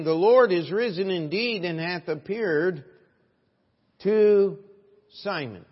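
ringing, emphatic scripture reading; loud proclamation. Acted. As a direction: proclaim the verse loud and high.

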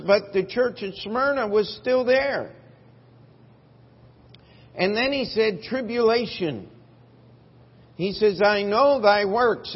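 loud, high, rising preacher's cry; full-force preaching. Acted.

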